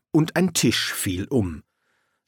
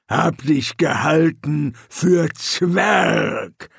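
slow troll; 5 semitones higher, 3 dB louder, much slower than usual. A narrator's reading. Troll speaks.

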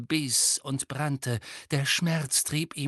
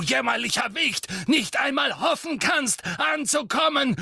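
high, forceful tirade; fanatical boasting shout; 10 semitones higher, 4 dB louder than usual. A narrator's reading. Bellatrix boasting to Harry of her Lord's teaching, shouting. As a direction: proclaim it loudly, full of scorn.

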